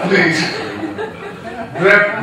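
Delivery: normal talking voice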